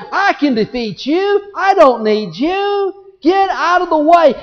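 lightly voiced proud challenger; defiant Antichrist. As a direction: taunt him as if you can't lose. Antichrist defies the Lord Jesus.

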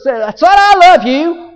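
loud, high, drawn-out declaration; joyful outburst. Acted. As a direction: proclaim love at full voice, slowly. Father greets his returning son.

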